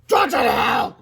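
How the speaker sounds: high, loud holler; furious shout. A narrator's reading. Principal at the fourth graders' table, shouting. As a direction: yell it across the room. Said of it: "shouted Mister Krupp".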